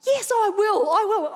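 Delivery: high, loud, emphatic acceptance; acted enthusiastic reply; eager agreement. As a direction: act out a mother bursting to say yes. Acts mother's eager agreement.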